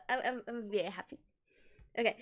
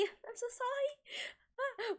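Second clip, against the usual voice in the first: mock apology; 10 semitones higher, 8 dB quieter, much slower than usual.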